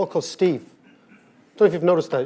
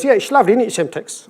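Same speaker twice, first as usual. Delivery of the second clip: lightly voiced Cockney, higher; Cockney trader; 5 semitones higher, 4 dB louder than usual. Big Steve to his colleague about his watch, light Cockney voice. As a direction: answer as a Cockney showing off, pitched up. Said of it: "He goes".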